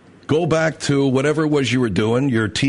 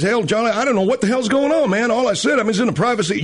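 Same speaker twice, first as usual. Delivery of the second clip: whining politician; 6 semitones higher than usual. Retold or imitated. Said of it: "and he says".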